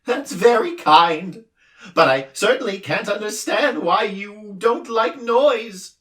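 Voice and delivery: squeaky-high noisy little being; din creature voice. A narrator's reading. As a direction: din puzzled why Milo dislikes noise. Din's voice.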